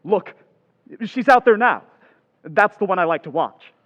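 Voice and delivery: loud, eager, high at first; eager outburst easing slightly; excited pointing out. Acted. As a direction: show someone an exciting sight. Boy spots girl from window.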